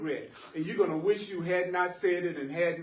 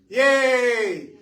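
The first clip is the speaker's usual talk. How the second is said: loud, high drawn-out cheer; celebratory cheer; 6 semitones higher, 10 dB louder than usual. Real, not acted. Teacher applauding the class for having some willingness, celebratory cheer. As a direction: cheer them on at full voice.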